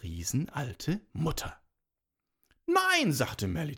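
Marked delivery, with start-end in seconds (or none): 2.7-3.1 put-on very high, loud little-girl no; defiant refusal. A narrator's reading. Girl keeps her golden city to herself, defiant high refusal. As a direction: refuse high and loud, guarding what is yours.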